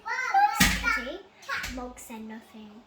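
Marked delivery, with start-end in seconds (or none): 0.0-1.2 loud, high and slow doting voice; drawn-out coo over the cat; fond coo. A narrator's reading. Boy hugging the cat, slow high doting coo. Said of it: "He hugged her and said".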